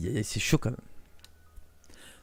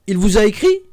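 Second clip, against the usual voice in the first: sharp question; 6 semitones higher, 8 dB louder than usual. Acted. Narrator interrogates cousin.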